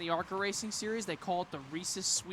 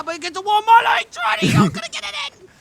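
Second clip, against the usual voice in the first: much higher and louder, shouting the play; excited shout; 14 dB louder than usual. Real, not acted. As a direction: yell it as the shot nearly goes in.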